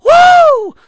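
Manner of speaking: loud high woo; preacher's ecstatic shout; shout of praise. Real, not acted. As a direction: belt a joyful woo to the rafters. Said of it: "Is it gonna upset you a little bit if I shout".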